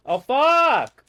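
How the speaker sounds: loud, high curse; exasperated outburst. Real, not acted. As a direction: cry out in sudden real frustration. Cursing at a sudden mishap.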